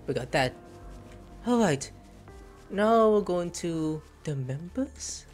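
ordinary talk, nothing marked

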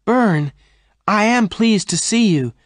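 high, loud glad cry; cried out. A narrator's reading. Jane greeting Bern on the trail, crying out gladly. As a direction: call out his name in delight. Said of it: "Jane cried".

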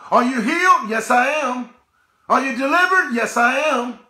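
usual speaking voice

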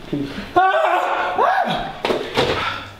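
sudden high cry when struck; high startled yelp; yelp of pain. Acted. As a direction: shriek as the blow lands. Hit on the head again.

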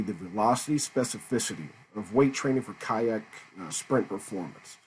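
ordinary talk

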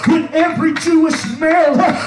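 driving, shouted pulpit cadence with gasped "uh"; full-force preaching. Acted. Preacher explaining the feast to the congregation, full-force preaching. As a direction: preach in punched phrases, building in intensity.

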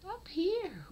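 whispered high call. Real, not acted. Calling kitten to camera.

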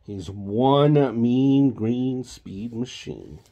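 slow, punchy announcer read; dramatic read. Acted. Parent reading a toy book to child, slow announcer read. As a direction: boom it out like a toy ad.

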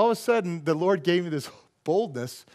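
normal talking voice

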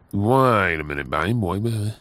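ordinary talk

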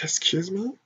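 breathy whispered disbelief; incredulous whisper. Retold or imitated. Recalling an older man's insult, a whispered disbelief. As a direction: whisper it, stunned and offended. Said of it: "And I was like".

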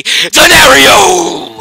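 loud, high play-by-play cry; announcer shout; hyped call. Real, not acted. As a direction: call the catch with hype. Calling another play.

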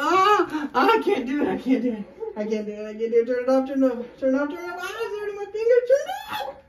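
loud, high, frantic begging; panicked pleading. Real, not acted. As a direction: beg them to stop, in real pain. Simulator at seven, begging stop.

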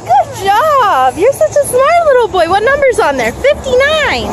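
put-on high, bright praise for a toddler; talking to a toddler. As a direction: cheer the little one on.

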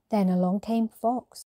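ordinary delivery, nothing marked

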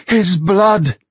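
high, loud gasping exclamation; startled prophetess crying out. Acted. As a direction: cry out at the raindrop. Raindrop startles girl.

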